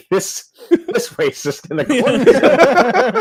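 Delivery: laughing through the words, pitch jumping up. Real, not acted. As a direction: crack up on the punchline. Laughing at punchline.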